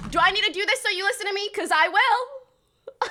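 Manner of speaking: loud, high warning with a stretched ending; mock-threatening yell. Real, not acted. Threatens to keep yelling at chat.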